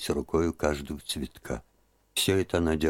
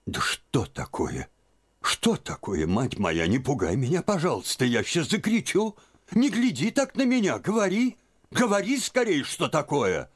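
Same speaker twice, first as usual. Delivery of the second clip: put-on frightened old aunt; 11 semitones higher than usual. A narrator's reading. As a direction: panic building to a cry. (тётка пугается, голос всё выше)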